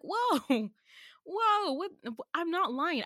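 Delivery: high stunned "whoa, whoa, what?". Retold or imitated. Narrator to A after being called a liar, stunned. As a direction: recoil in disbelief, voice up high.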